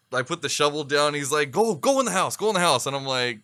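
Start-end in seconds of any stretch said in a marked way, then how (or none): 1.5-2.9 higher-pitched, repeated shooing command; dad's urgent order. Retold or imitated. Dad sending his sick son indoors, urgent order. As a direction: imitate a father hurrying his kid away.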